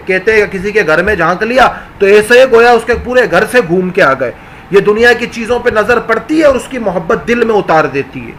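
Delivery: ordinary talk, nothing marked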